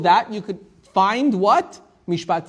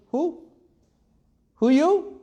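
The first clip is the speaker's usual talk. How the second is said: incredulous; 8 semitones higher, slower than usual. Retold or imitated. Mari doubts stranger claiming brotherhood.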